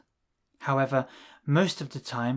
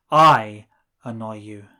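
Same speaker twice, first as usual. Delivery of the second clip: I annoy you with the I loud and lower; stress on I; 3 semitones lower, 11 dB louder than usual. Shown as a demonstration. Teacher modelling the emphatic version for translation. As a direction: slam the word I. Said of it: "you raise your voice to emphasize something".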